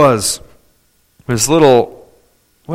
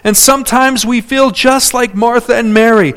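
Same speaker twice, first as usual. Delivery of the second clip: normal talking voice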